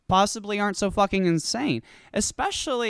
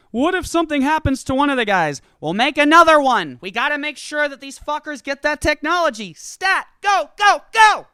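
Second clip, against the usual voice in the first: much higher and louder, building to yelling go; urgent frantic orders; 7 semitones higher, 7 dB louder than usual. Acted. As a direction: rush the crew like an emergency.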